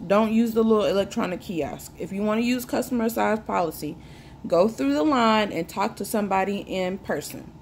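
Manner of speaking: normal talking voice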